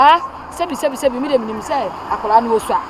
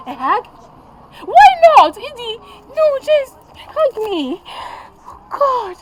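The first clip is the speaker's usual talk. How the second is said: high, slow anguished cry; 11 semitones higher, 4 dB louder, much slower than usual. Acted. Mother in anguish over her dying daughter. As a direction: break down asking why.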